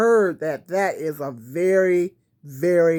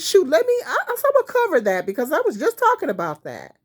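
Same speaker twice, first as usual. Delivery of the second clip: excited retelling; 11 semitones higher, 3 dB louder, faster than usual. Retold or imitated.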